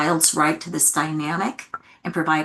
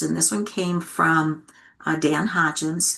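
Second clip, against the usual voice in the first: normal talking voice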